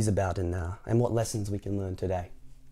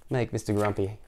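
plain reading voice

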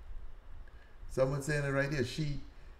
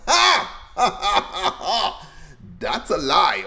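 laughing scoff; 6 semitones higher, 4 dB louder, much slower than usual. Retold or imitated. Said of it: "And I'm going".

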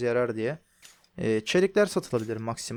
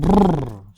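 loud lip trill, brrr; playful brrr; 9 dB louder than usual. Acted. Calming a creature in game.

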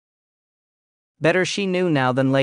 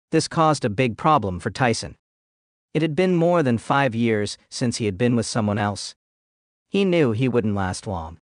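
plain reading voice

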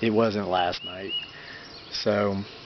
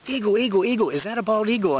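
much higher, louder, thrilled cry; all excited; 11 semitones higher, 3 dB louder than usual. Retold or imitated. Wife spotting vultures by the road, excitedly high and loud. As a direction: squeal it out like spotting a treasure. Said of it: "my wife gets all excited".

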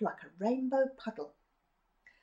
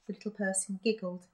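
usual speaking voice

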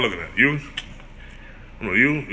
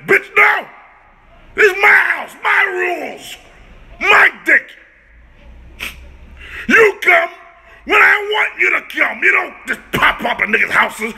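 angry rant; 6 semitones higher than usual. Real, not acted. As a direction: tell her off at full volume. Ranting at the girl who calls.

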